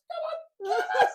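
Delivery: high, loud, drawn-out taunt; goading shout. Retold or imitated. Runner ahead of his teacher in a race, goading. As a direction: egg the rival on at full voice.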